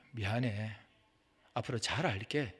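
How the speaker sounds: soft, low, conciliatory; meek apology. Acted. Believer apologizing to the one lashing out, meek apology. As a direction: back down gently and quietly.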